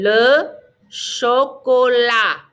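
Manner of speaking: LE CHOCOLAT slowly, ending on AH; teacher's slow LE CHOCOLAT, T dropped; silent final T. Shown as a demonstration. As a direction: stretch out CHOCOLAT with no T at the end. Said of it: "Don't pronounce the T".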